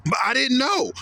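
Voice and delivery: pleading sinner. Retold or imitated. Sinner pleading with Peter at the gate, high whiny protest. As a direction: beg like a caught sinner.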